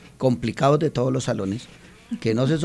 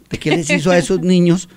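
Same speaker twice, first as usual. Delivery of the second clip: higher, louder retold question of disbelief; imitated astonished teacher; 6 semitones higher, 5 dB louder than usual. Colleague asking about his transformed class, mock astonishment. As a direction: mimic a colleague's amazed question, raised in pitch. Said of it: "me decía la profesora Amanda".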